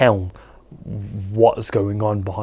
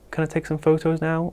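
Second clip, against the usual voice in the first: higher, softer unsure mock voice; timid asking; 4 semitones higher, 6 dB quieter than usual. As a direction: ask permission meekly, as a hesitant photographer. Mimics passive, unsure photographer.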